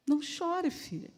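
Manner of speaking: hushed, tender answer; soft consoling murmur; compassionate. Acted. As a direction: soothe a desperate daughter, trailing to almost nothing. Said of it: "foi um não chore de compaixão".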